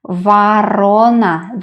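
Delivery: Varona slowly, A in the first syllable, long RO; stressed middle syllable. Shown as a demonstration. Teacher repeats the word for learners, Varona stressed on RO. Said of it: "The middle syllable is stressed".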